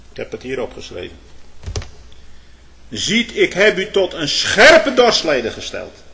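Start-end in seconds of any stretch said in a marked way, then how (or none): 2.9-6.0 loud, declaimed Scripture with stretched, lifted key words; plechtig Bijbelcitaat. Real, not acted. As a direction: proclaim the prophecy with force. (luid en gedragen voorgedragen)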